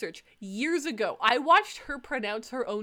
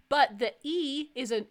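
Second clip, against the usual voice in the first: ordinary talk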